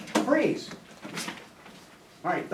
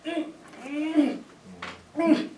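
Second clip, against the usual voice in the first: impatient wordless grunting, higher and softer; mock impatient whine; 7 semitones higher, 5 dB quieter, slower than usual. Teacher showing the class a bad choice, mock impatient. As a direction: act out an impatient pupil's "mm, mm". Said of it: "if you got a little impatient".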